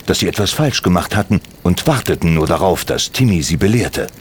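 ordinary delivery, nothing marked

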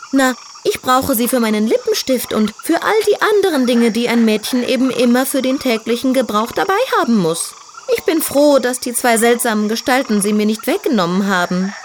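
put-on high, vain young piglet voice; girly piglet voice. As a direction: play a proud little diva pig. Tütü explains.

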